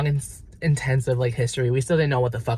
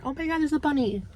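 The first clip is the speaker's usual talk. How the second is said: delighted squeal; 13 semitones higher, 4 dB quieter, faster than usual. Real, not acted. Sudden aside spotting a bunny.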